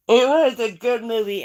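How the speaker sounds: speaking through a yawn. Real, not acted. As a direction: yawn mid-sentence. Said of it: "I'm yawning".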